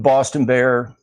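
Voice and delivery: normal talking voice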